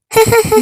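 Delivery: high, loud burst of giggling; pretend giggle. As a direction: giggle out loud all by yourself, playfully put on. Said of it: "مالك يا [name] بتضحكي وحدك". (ضحكة تمثيلية عالية)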